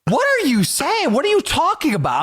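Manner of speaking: high, slowed baffled voice; confronted in a bar by a drunk kid, high and baffled; confused. Retold or imitated.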